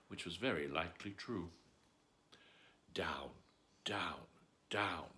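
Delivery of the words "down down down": ordinary delivery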